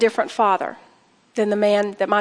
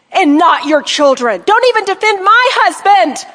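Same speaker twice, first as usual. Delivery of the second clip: slow, high, loud furious yelling; furious shout; 9 semitones higher, 7 dB louder than usual. Retold or imitated. Mother yelling at her daughter about her grandchild, furious. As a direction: shout at her with years of hurt boiling over. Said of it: "I was so angry I couldn't stand it".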